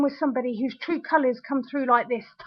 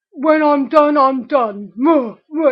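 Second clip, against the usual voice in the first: loud, grunting caveman imitation; mock caveman; 6 dB louder than usual. Angry partner mimics her ex's stubbornness, mock caveman. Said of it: "It's almost caveman mentality".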